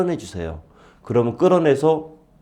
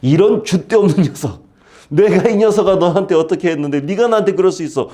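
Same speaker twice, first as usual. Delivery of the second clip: mock scolding; 6 semitones higher, 3 dB louder than usual. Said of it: "삐져서 투정 부리는 아브라함을 향해서".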